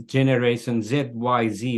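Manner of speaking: normal talking voice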